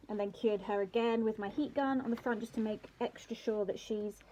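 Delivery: normal talking voice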